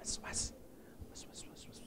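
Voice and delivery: breathy unvoiced whisper, barely audible. Shown as a demonstration. Preacher showing the congregation how was-was sounds, whispered. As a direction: hush it like a secret in the ear. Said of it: "Kayak kita nyebutin ndak keras-keras".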